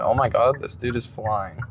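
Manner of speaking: usual speaking voice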